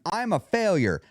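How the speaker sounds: high-pitched, harsher statement; harsher absolute. Shown as a demonstration. Demonstrating again for listeners, harsher absolute. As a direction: deliver the absolute as a definitive verdict. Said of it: "one of them sounds harsher than the other".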